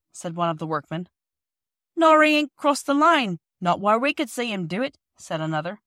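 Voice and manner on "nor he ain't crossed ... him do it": rough workman voice. Acted.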